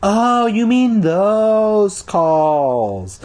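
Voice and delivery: high, drawn-out, hammed-up innocence; feigned innocence. A narrator's reading. Kid caught out by dad, hammed-up feigned innocence. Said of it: "I tried to sound innocent".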